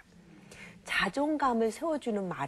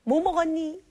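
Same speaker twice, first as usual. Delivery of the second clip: higher, louder fussing parent; mock parent question; 7 semitones higher, 6 dB louder than usual. Mom asking child about lunch, fussing. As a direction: ask like a busy mom checking in. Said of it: "아니면".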